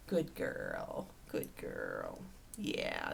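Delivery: deep soft goofy voice; very low dog praise. Real, not acted. Owner praises dog in very low soft croon.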